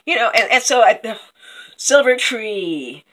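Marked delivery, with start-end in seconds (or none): none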